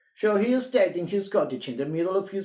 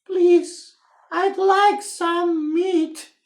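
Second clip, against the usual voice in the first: high, louder, slow plea; trying louder; 14 semitones higher, 6 dB louder, much slower than usual. Acted. Mister Quiet trying again at the butcher, louder. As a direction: push the voice out harder. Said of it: "Mister Quiet tried again".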